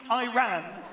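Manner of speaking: loud recap shout. Retold or imitated.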